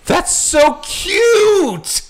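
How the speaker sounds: very high, slow gushing coo; gushing stranger. Retold or imitated. Stranger at the bar cooing at them, very high and slow. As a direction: coo it out slowly like a charmed stranger. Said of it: "her reaction was".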